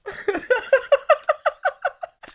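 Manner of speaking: high, long laughing fit; helpless laughter. Real, not acted. Laughing at own Batman's gloves joke, high helpless laugh. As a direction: crack up in high peals.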